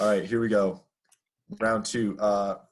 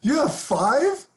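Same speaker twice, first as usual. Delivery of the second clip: surprised; 5 dB louder, much slower than usual. Real, not acted. Hearing friend's score.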